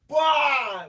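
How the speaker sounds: huge bellowed Bah, far above the speaker's voice; Viking snow bark. Retold or imitated. Vlogger mimics an Icelander facing snow with a bellowed Bah. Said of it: "a Viking or an Icelander looks at snow and just goes".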